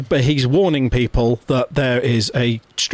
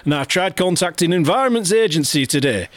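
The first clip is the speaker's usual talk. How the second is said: higher Geordie voice reading out a Facebook post; Geordie accent; 7 semitones higher than usual. Pretend. Podcast host reads a lake monster post to his co-host, in Geordie. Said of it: "Oh, I'm gone Geordie".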